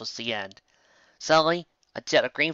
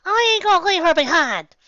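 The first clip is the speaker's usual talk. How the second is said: filly yelling; 10 dB louder than usual. Acted. Little filly yelling at her big sister, high and loud. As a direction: yell it out like a stubborn little filly. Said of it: "the filly yelled".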